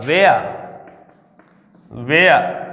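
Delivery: "वेयर" said as wair, h silent, stretched out; modelling pronunciation. Shown as a demonstration. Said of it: "इसका क्या होगा?".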